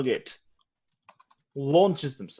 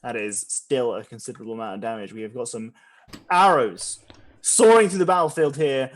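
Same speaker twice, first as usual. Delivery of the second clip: ordinary talk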